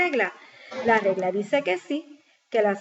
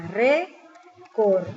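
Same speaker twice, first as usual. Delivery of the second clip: récord split into ré and cor, louder; sílaba por sílaba; 5 dB louder than usual. Shown as a demonstration. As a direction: sound out récord in two separate beats. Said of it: "divido en sílaba". (récord separado en sílabas)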